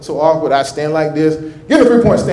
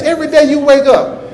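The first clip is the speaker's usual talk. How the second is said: ordinary delivery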